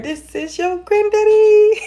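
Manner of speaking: very high, slow, squeaky spirit voice through laughter; hammed-up granddaddy spirit voice; mock spirit voice. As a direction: squeak it like a silly ghost granddad. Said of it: "I heard".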